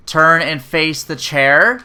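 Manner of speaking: loud, slow command; imitated stern order. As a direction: spell out an order to someone not listening. Retold curt order from chiropractor.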